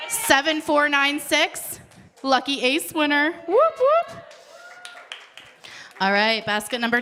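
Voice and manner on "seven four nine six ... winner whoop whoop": high announcer's call into a whoop; excited winner call. Real, not acted. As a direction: celebrate the winner, pitch climbing to a whoop.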